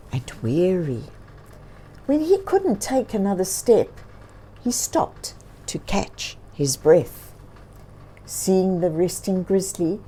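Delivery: plain reading voice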